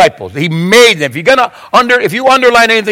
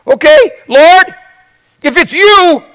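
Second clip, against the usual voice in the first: eager Peter; 9 semitones higher, slower than usual. Retold or imitated. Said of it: "Peter said".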